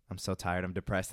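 low, subdued, dejected; downcast answer. Acted.

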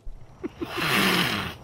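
imitated feline hiss; cat hiss. Podcaster acting out Chimera facing the dragon, cat hiss. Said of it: "she hisses at the dragon".

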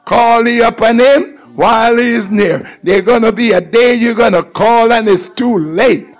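ordinary talk, nothing marked

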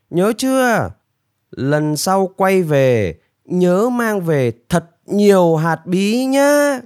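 high, drawn-out lecturing voice; self-important high-voiced benefactor; demanding bird rescuer. A narrator's reading. Narrator voices man lecturing the bird.

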